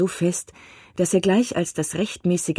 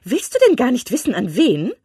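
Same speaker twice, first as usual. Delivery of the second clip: high, loud impatient cry; 9 semitones higher, 6 dB louder than usual. A narrator's reading. Wife impatiently pressing husband to ask who, loud and high. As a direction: push him, can't wait for him to ask. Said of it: "rief seine Frau ungeduldig".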